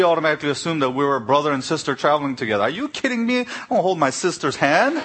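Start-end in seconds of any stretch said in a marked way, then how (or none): 2.6-5.0 high, laughing disbelief; incredulous retort. Retold or imitated. Telling the clerk off, high and incredulous. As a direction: pitch way up in mock outrage.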